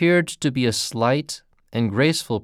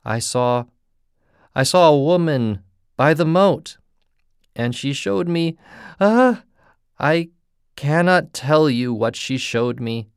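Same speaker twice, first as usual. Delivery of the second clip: terrified; 5 semitones higher, 4 dB louder than usual. Acted. Terrified man recounts horror.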